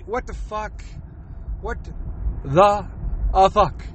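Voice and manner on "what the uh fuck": ordinary delivery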